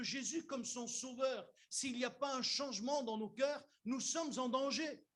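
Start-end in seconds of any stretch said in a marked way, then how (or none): none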